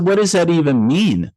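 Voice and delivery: mock-bewildered comeback; baffled retort. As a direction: act out mild disbelief, pitch climbing. Retold baffled reply to psychic.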